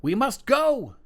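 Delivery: high, loud urgent cry; mother crying to her son to escape; mother cries out. Acted. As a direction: urge the son to flee. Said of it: "she cries to her son".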